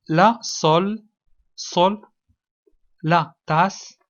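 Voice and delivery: word-by-word dictation, pauses between. Shown as a demonstration. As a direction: dictate each piece separately and firmly. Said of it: "écris les mots sous les images". (إملاء متقطع وواضح)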